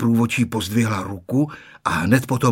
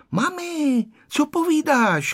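calling out; slower than usual. A narrator's reading. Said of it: "křičel Honzík".

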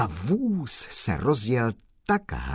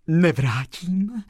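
slow, higher word let out on a breath; 8 semitones higher, 4 dB louder, slower than usual. Acted. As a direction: breathe the refusal out slowly. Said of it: "vydechla slečna Marie".

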